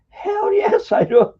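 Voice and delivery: high, loud burst of eagerness; emphatic eager yes. Retold or imitated. Accepting the offer to meet the famous designer's son. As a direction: grab the chance with both hands. Jumping at meeting the father.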